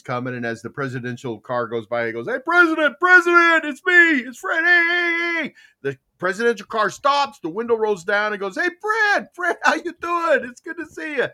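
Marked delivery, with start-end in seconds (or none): 2.3-5.5 very high, loud hailing; acted shout. Fred hails the passing motorcade. 8.6-11.3 very high-pitched friendly hail; acted called greeting. President greets Fred from car.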